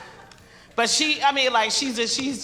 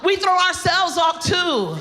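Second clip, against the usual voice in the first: shouted punchline; 9 semitones higher, slower than usual. Shown as a demonstration. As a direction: belt the punchline out. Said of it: "We throw you off".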